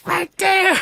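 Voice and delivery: very high, strained cry; acted exasperated urgency, voice shooting up; urgent pointing-out. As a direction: re-enact a frantic reply to a partner.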